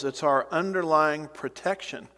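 ordinary talk

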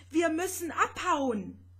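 urgent cry to escape the sea; shouting over the roar. A narrator's reading. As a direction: yell it out above the storm. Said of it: "Vanya musste jetzt brüllen".

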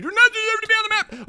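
high-pitched indignant squawk; worked-up high outburst; outraged rant. Real, not acted. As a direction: scold the rival way up high. Berates opponent in game.

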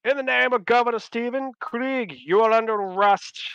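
high, ringing arrest announcement; official proclamation. Acted. Giles arrests governor before his troops, loud proclamation. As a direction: declare it before the whole courtyard.

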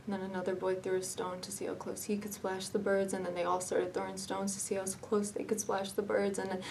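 normal talking voice

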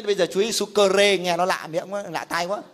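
usual speaking voice

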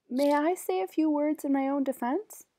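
ordinary delivery

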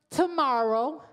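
tomorrow set apart and said loud; a strong, drawn-out tomorrow; stress on tomorrow. Real, not acted. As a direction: punch the word tomorrow after a pause. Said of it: "I emphasize the word tomorrow".

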